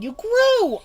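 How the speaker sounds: very high, loud startled shout; shocked yell. A narrator's reading. Girl sees the grown monster, high shocked yell. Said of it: "I yelled".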